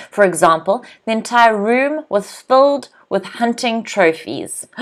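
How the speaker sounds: ordinary delivery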